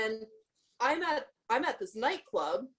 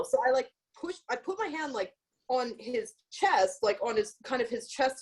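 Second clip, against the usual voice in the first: usual speaking voice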